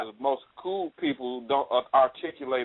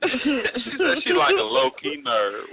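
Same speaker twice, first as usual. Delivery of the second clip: mocking imitation; 7 semitones higher, much slower than usual. Mocking her friend's words.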